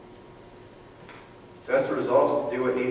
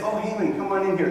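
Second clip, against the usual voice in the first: higher, welcoming call to Haman; 7 semitones higher than usual. Retold or imitated. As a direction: beckon Haman in brightly. King calls Haman inside.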